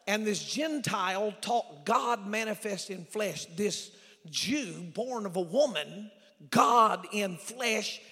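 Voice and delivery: loud, slow, stretched-out key words; full-force preaching. Real, not acted. Preacher to congregation on God shocked by a Gentile, full-force preaching. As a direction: thunder it slowly from the pulpit.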